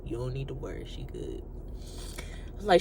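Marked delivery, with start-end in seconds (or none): 0.0-1.4 low, soft reassurance; hushed, low, repeated reassurance; voice of the spirit. Retold or imitated. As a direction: murmur it as an inner prompting. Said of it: "the spirit is saying".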